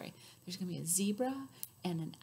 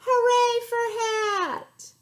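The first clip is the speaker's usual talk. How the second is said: high, loud, stretched cheer; 13 semitones higher, 10 dB louder, slower than usual. Shown as a demonstration. Elephant and Zebra cheering together, long loud cheer. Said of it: "Can you cheer with them?".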